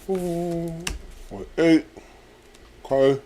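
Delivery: talking around a pen; muffled by a pen in the mouth. Real, not acted. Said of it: "I've got a pen in my mouth. That's why I'm talking like this".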